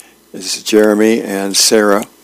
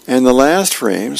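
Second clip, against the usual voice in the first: normal talking voice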